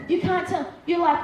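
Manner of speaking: normal talking voice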